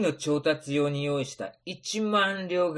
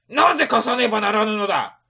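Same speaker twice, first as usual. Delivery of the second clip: indignant; 5 semitones higher, 7 dB louder, faster than usual. Acted. Samurai refuses loan.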